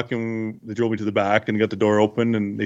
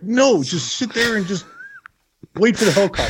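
bossy owner; 9 semitones higher than usual. Retold or imitated. Owner ordering him to wait.

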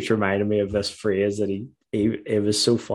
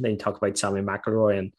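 ordinary talk, nothing marked

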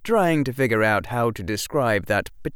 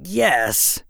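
put-on higher, louder boy, mildly annoyed; annoyed and frustrated; 10 semitones higher, 5 dB louder than usual. A narrator's reading. Boy confirming the legend, annoyed and frustrated. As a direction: answer with contained frustration. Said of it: "annoyance and frustration warring in him".

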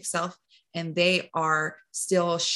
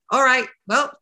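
higher, louder, breezy brush-off; mock unbothered, higher and louder; dismissive shrug-off; 5 semitones higher, 6 dB louder than usual. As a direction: toss the gossip aside like it's nothing. Brushing off gossip about her.

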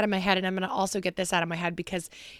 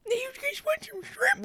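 put-on tiny, high-pitched old lady voice; Grammy McKenzie; 3 dB quieter than usual. Running family joke about great-grandmother offering shrimp, high old-lady imitation. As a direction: squeak it out like a little granny. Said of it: "This is her imitating our great-grandmother".

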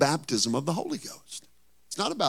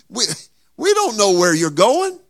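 Thomas confused; 10 semitones higher, 7 dB louder than usual. Acted. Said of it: "And so Thomas says".

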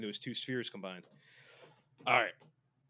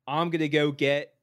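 silly put-on voice; 3 semitones higher, 8 dB louder than usual. Host annoying co-host, silly put-on voice. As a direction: ham it up in a goofy voice. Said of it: "just me doing different voices".

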